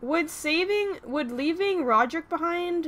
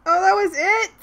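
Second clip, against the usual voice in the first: surprised; 7 semitones higher, 3 dB louder than usual. Real, not acted. Surprised that the episode ended.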